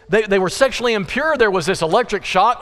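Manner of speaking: ordinary talk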